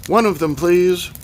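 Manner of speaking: put-on loud, high Russian-accented request. A narrator's reading. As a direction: call out politely with a Russian accent. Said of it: "said someone with a Russian accent".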